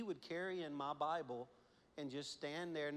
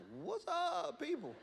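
high, cocky casual greeting; swaggering cool kid; 13 semitones higher, 4 dB louder, slower than usual. Retold or imitated. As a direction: strut in like you own it. Son swaggers up the aisle.

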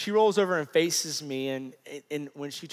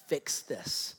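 hushed, as if thinking to himself; retold inner thought; 3 semitones lower, 6 dB quieter than usual. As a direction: say it under your breath, to yourself.

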